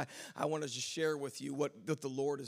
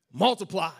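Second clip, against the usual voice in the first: proclaimed banner word; 5 semitones higher, 15 dB louder than usual. Shown as a demonstration. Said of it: "background that said".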